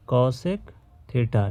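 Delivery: cosec theta drawn out very slowly, syllable by syllable; teacher dictating cosec theta at a crawl; slow spelled-out term. Shown as a demonstration. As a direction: stretch cosec theta out so students can copy it. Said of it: "क्या बोलते हैं?".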